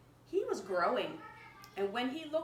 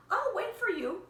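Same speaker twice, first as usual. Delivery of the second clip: high, louder eager promise; a loud, higher bird voice; Marcel's voice; 11 semitones higher, 7 dB louder than usual. Acted. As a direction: reassure her he will wait. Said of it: "Marcel said".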